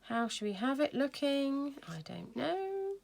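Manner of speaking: playful high-pitched indecision; musing aloud. Retold or imitated. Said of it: "I just thought".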